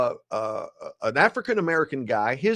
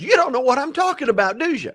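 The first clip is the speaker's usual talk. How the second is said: cellmate calling bluff; 8 semitones higher, 3 dB louder than usual. Retold or imitated. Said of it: "he says".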